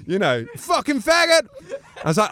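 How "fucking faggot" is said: high-pitched imitation of an angry heckler; mock hateful yell. Retelling the stadium crowd's abuse, mock angry yell. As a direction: yell it like someone in a hostile mob.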